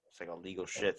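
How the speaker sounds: ordinary delivery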